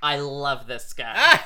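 loud, slow mock declaration; Marlon. Actor delighted by his new friend, slow and emphatic. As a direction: draw it out loudly as an imitated actor. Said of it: "And then Marlon goes".

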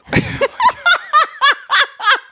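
shrieking laugh; real shrieking laugh; high burst of laughter. Real, not acted. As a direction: break into a squealing laugh. Big laugh.

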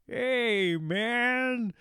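high, drawn-out celebrity impression; slow, high imitation of a sitcom star; Ray Romano. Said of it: "that's my best friend".

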